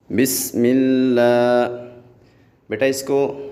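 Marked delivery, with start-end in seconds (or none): 0.1-1.7 slow, drawn-out Quranic recitation. Shown as a demonstration. Teacher starting class with slow recitation. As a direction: chant it slowly like tajweed. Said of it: "چلیں تو class شروع کرتے ہیں".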